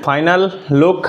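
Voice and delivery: ordinary talk, nothing marked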